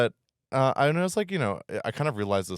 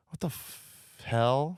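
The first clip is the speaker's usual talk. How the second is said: shocked disbelief; 7 semitones higher, much slower than usual. Retold or imitated. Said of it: "and I was like".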